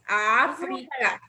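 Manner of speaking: África slowly as Á-fri-ca; África in three measured syllables. Shown as a demonstration. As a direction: stretch the opening Á of África. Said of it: "Tiene tres sílabas".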